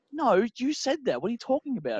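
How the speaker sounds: retold protest, throwing the blame back; indignant denial. Protesting to her that he said it, indignant.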